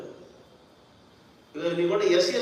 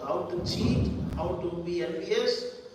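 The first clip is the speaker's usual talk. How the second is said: normal talking voice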